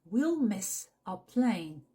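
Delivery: "we'll miss our plane" slowly, word by word; slow demo. Shown as a demonstration. Teacher slows it down for the learner. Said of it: "Ça va se dire".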